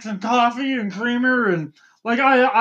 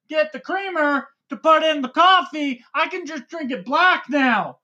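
excited yelling; 7 semitones higher than usual. Real, not acted. Host yelling his coffee excitement at his cohost. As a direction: bounce off the walls with joy. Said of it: "why are you yelling?".

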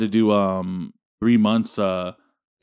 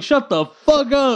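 high, slow scoffing retort; mock dismissal; 11 semitones higher, slower than usual. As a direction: drag it out in disbelief. Mocking friend's bragging.

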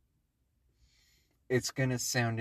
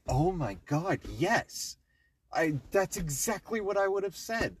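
high, loud excited gush; thrilled exclaiming; 11 semitones higher, 6 dB louder than usual. Retold or imitated. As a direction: gasp it out like a delighted fan. Imitating gamer's thrilled reaction.

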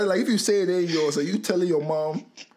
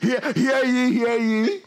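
town crier; 6 semitones higher, slower than usual. Pretend. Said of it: "Hear ye would be something like that".

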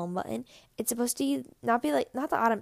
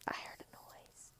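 very soft whisper; ASMR whisper; 24 dB quieter than usual. Real, not acted. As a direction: whisper it right down, barely audible. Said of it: "I guess this is an A S M R channel now".

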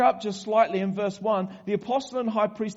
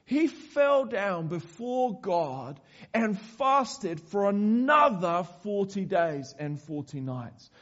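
ordinary talk

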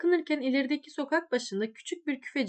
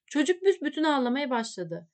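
ordinary delivery

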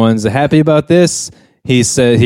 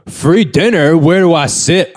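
rude freeloader; 6 semitones higher than usual. Retold or imitated. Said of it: "who's very rude in this scene".

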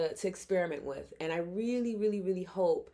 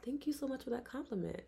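put-on softer, higher voice for a child; tender to child; 6 semitones higher, 7 dB quieter than usual. Woman thanking a little girl, soft and sweet. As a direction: gently gush thanks, kept soft. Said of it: "I immediately get to eye level".

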